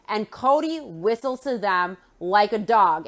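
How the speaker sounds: usual speaking voice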